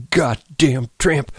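slow, high-pitched venom; vicious. A narrator's reading. Candy curses the dead wife, viciously. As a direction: spit out each word slowly, old man's anger. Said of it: "he said viciously".

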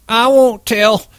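high, urgent promise; Curly promising Slim, high and eager; Curly submitting. Acted. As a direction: blurt it out quickly. Curly giving in.

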